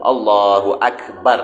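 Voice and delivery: Akbar with the r stopped dead; silenced final ra. Shown as a demonstration. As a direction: clip the r of Akbar short, no vowel after it. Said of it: "kita kena matikan huruf ra".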